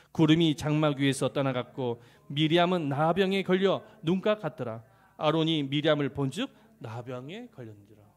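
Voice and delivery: very loud congregational reading; loud recited Bible verse; scripture reading aloud. Acted.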